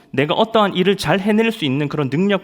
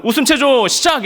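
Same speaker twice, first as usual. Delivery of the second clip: much higher, louder officer's shout; shouted mock order to start laughing; drill command; 11 semitones higher, 6 dB louder than usual.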